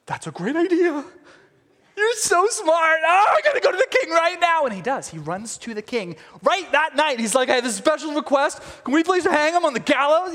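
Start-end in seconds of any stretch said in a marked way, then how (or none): 0.1-4.6 high, loud gushing excitement; over-the-top thrilled outburst, words stretched out; mock delight. Haman delighted at his wife's plan. 7.5-10.3 high pleading mock request; hammed-up eager petitioner; eager rehearsed request. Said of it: "rehearsing it as he goes".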